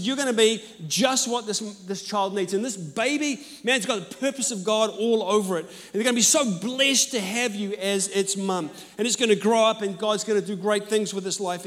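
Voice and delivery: rising hopeful assurance; fervent encouragement. Retold or imitated. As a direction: lift her up with conviction. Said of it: "I said".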